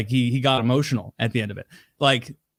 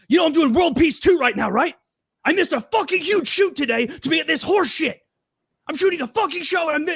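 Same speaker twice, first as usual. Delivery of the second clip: very high, strained yelled rant; mock meltdown; 13 semitones higher than usual. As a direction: imitate a host ranting as he loses it. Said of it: "he's, he's losing it".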